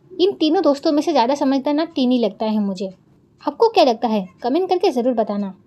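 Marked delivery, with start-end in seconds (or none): none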